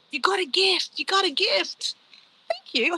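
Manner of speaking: high, put-on singsong voice; playful announcement. Streamer announcing a gift on the livestream, playful high voice. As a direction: chirp it high, drawing out the repeat.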